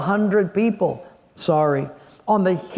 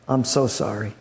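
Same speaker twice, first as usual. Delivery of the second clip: low, heavy sorrowful voice; a grieving messenger softly delivering terrible news; somber messenger; 10 semitones lower, 3 dB quieter than usual. Acted. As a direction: break the bad news gently and heavily. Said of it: "comes in somber, looking very, very down".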